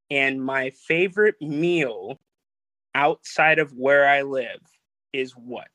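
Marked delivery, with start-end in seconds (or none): none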